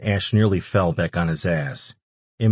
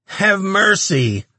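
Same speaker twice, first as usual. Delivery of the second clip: very high, drawn-out creature plea; begging; 13 semitones higher, 5 dB louder, much slower than usual. A narrator's reading. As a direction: beg loudly for your life. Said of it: "the demon begged".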